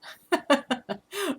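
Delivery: bright high laugh at a chat comment. Real, not acted. Streamer laughing at a viewer's comment, high laugh. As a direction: burst into a high giggle.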